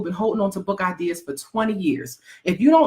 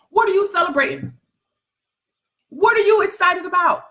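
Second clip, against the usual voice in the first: much higher, punchy what are you celebrating; incredulous challenge; 10 semitones higher than usual. Acted. Calling out broke partiers, much higher and incredulous. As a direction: demand an answer, incredulous.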